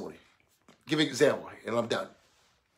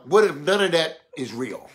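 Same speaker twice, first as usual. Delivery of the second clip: ordinary delivery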